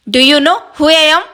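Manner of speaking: loud, slow, indignant demand; furious, drawn-out challenge; angry shout. A narrator's reading. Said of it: "కోపంగా అరుస్తూ".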